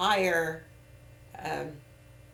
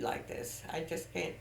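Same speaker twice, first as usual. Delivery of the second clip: whispered; 10 dB quieter than usual. Real, not acted. Upset over son's attitude.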